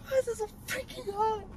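soft, very high squeaky whimper; small high crying voice; tearful whine. Real, not acted. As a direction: whine it on the verge of tears. Tearful complaint in hot car.

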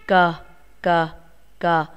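Cờ said short and clear, repeated; model the sound. Shown as a demonstration. Teacher shows pupils the sound Cờ, crisp. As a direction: give Cờ crisply for the class. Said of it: "nghe cô đọc và đọc theo cô".

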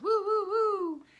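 high, loud siren wail imitation; siren woo. Shown as a demonstration. Teacher showing kids the siren sound, high loud wailing. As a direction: wail it like a fire truck siren. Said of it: "It says".

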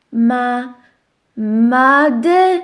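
powolne literowanie. Shown as a demonstration. Powolne odczytywanie metki.

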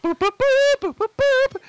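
high, loud hooting boops; imitated ocarina notes. Mimicking the ocarina being played, high loud boops. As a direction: toot it out like a little flute.

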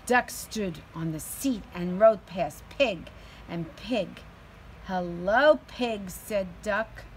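teacher reading a picture book aloud to her class; reading to children. A narrator's reading. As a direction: read it out with energy for little kids.

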